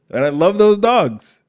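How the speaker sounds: slow loud passionate declaration; loud emphatic. Real, not acted. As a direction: hammer it home with a loud and slow delivery. Repeats dog line louder.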